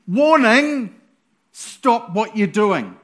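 loud warning. Acted. Prophet warns the king.

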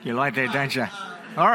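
ordinary delivery

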